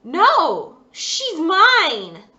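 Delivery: high, loud cry of no; a girl's loud, high refusal; defiant shout. Acted. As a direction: clutch the doll and shout it. Girl refuses to give doll.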